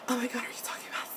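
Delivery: hushed, rushed whisper; excited whisper. Retold or imitated. Sister to her brother about his love life, excited whisper. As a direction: whisper it like scandalised gossip.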